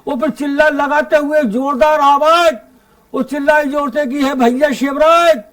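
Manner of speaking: lilting, declaimed humorous verse; comic poem recitation. Acted. Poet telling the Holi mishap to the audience, comic verse recitation. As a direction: recite in measured lines for a live crowd.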